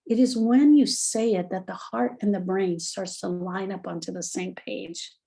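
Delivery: ordinary talk, nothing marked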